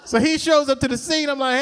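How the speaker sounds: usual speaking voice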